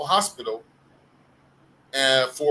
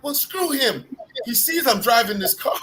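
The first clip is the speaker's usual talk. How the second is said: high-pitched imitation of an offended friend; indignant retort; 9 semitones higher than usual. Imitating friend refusing to calm down, high indignant mock protest. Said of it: "he was really mad".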